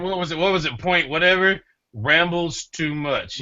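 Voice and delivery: loud, high mock complaint; hammed-up protest; mock outrage. Mocking a complaint.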